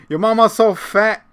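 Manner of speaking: high, loud joke-teller voice; mock mama joke. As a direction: launch the roast, hammed-up.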